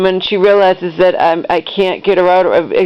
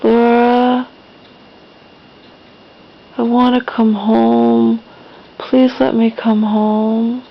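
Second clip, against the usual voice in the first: slow, drawn-out plea in a higher voice; lightly voiced imploring mother; pleading mother; 4 semitones higher, slower than usual. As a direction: imitate a mom begging, words stretched out. Voices mom pleading to come home.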